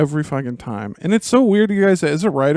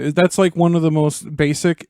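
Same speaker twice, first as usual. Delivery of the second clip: usual speaking voice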